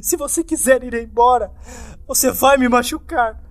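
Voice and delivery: high, loud sobbing accusation; terrified weeping; sobbing plea. Acted. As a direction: cry it out, rocking in fear. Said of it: "continuou a soluçar e balançar".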